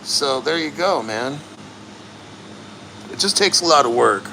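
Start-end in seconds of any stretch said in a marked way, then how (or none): none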